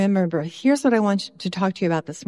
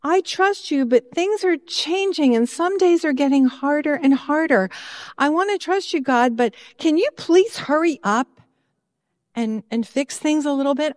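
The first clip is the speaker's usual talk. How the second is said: raised pitch, pleading with God; higher, insistent prayer of frustration; frustrated plea to God; 5 semitones higher than usual. Retold or imitated. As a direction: complain to God, half-exasperated. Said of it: "I even say to God".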